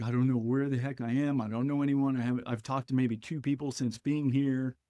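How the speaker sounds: usual speaking voice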